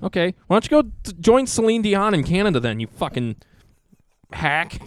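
fast, loud, high-pitched rant; sudden heated outburst over coffee; losing his cool. Real, not acted. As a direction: blow up at him in a rush. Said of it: "I almost lost my cool there".

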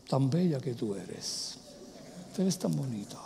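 very soft, low sweet talk. Shown as a demonstration. Husband calming a hysterical wife with compliments, tenderly. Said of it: "bájale la voz".

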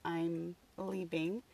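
normal talking voice

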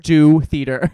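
loud, punchy mock order; forceful plug that breaks into a laugh; mock command. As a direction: playfully bellow it at the listeners. Playful order to listeners to join.

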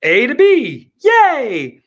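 mocking the media's triumphant spin; mocking gloat. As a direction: gloat like a kid who got away with it. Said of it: "then you could say".

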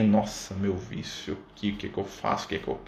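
hushed, fretting mock panic under the breath. Speaker mocks a person waking up fretting over their vice, hushed mock panic. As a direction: whisper it like someone caught by their vice at dawn.

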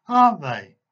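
the teacher pushing his triumph; gloating insistence. A narrator's reading. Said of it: "a triumphant smirk on his face".

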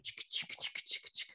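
imitated faint audio blips, whispered; barely audible whispered ticking noises; tiny whispered chicks. Wife mimicking husband on the audio, in whispered chicks. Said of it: "and you're like".

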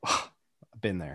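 sighed, low and slow; weary sigh. Real, not acted. Admitting old golf meltdowns with a weary sigh. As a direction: heave it out like a weary veteran.

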